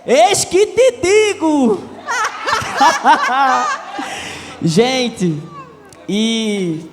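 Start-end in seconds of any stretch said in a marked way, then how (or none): 0.0-1.9 tom solene de pregador, alto e arrastado; entonação pentecostal. Retold or imitated. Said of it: "E tem que falar com a entonação". 2.0-4.6 1 risada aguda. Real, not acted. Riso após imitar pregador.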